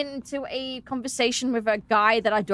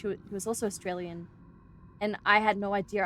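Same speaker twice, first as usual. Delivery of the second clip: ordinary delivery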